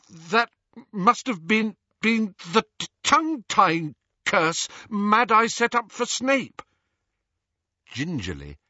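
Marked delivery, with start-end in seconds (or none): 0.1-6.6 high-pitched, halting stammer. A narrator's reading. Hermione after the tongue-tying curse, high stammer. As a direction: stumble and catch on the words, pitched high. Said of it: "Hermione stammered".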